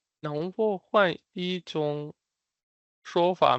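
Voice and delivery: 能否换一种 run together slowly, then 说法 after a pause; slow joined phrase. Shown as a demonstration. Teacher putting the phrase back together for learners, slow and joined. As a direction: say 能否换一种说法 slowly in two chunks.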